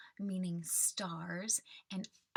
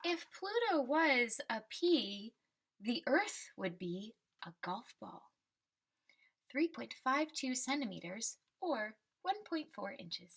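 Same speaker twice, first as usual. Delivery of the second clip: put-on reading to children. Teacher reading the class a picture book, storybook voice. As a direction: read the refrain like a picture book for kids.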